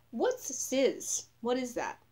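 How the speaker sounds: feigned innocence. Retold or imitated. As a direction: play dumb sweetly. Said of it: "acting like I wasn't".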